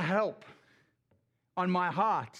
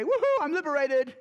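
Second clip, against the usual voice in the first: higher, louder whoop of glee; mock whoop; 7 semitones higher, 4 dB louder than usual. Preacher mocking a wrong idea of Moses with a whoop. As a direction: cheer it like a freed man.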